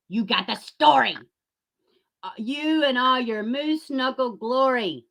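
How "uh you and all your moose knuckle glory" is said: ordinary delivery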